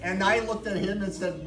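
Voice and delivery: normal talking voice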